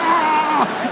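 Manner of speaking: long high hammed-up scream; mock panicked scream. Imitated panicking passenger cry.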